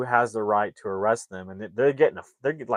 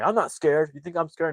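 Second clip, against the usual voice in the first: defiant bravado; 6 semitones higher than usual. Retold or imitated. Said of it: "He's like".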